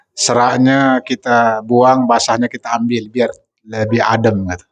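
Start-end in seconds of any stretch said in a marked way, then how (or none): none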